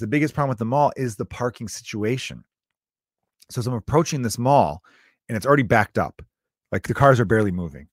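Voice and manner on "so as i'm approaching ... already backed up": usual speaking voice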